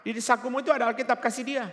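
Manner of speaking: high, loud, fast call imitating a wife; wife's shout. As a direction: holler urgently as the wife to her husband. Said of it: "istrinya teriak lagi". (menirukan istri berteriak mendesak)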